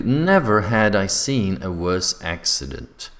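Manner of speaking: slow, with "Never" stressed up front; emphatic intonation. Shown as a demonstration. Teacher showing an inversion example, with emphatic intonation. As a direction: draw the sentence out, leaning on "Never". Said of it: "don't forget also to use intonation".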